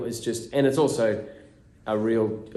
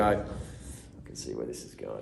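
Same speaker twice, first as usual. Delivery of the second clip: dry low prediction of a coming disaster; knowing mutter; 7 semitones lower, 7 dB quieter than usual. Retold or imitated. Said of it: "you go".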